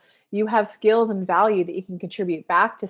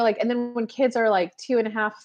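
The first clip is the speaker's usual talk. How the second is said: usual speaking voice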